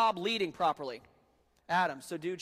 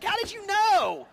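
much higher, slow, loud mock-amazed voice; surprised sister; 11 dB louder, slower than usual. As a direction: squeal it like a sister caught out. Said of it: "She's like".

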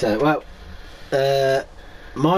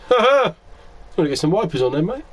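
mocking passer-by; 6 semitones higher, faster than usual. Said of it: "looks at you and goes".

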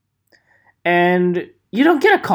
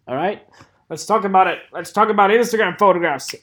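ordinary talk, nothing marked

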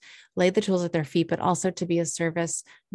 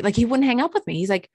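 usual speaking voice

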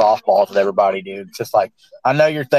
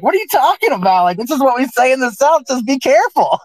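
much higher, baffled protest; exasperated disbelief; 10 semitones higher than usual. Retold or imitated. Explaining to Darcy a Southern warning, exasperated and much higher. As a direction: insist it's just Southern talk. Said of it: "And I was like".

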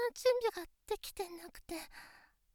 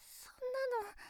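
whispered dread; 4 semitones higher, 9 dB quieter, slower than usual. Acted. Dreading a breakup.

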